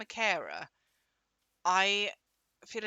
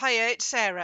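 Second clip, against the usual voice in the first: bright, loud hello into the phone; loud phone greeting; 9 dB louder than usual. Retold or imitated. Granddaughter greeting Auntie Nancy on the phone, loud. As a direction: announce yourself loudly down the phone.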